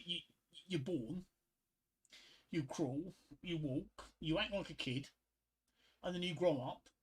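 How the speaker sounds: normal talking voice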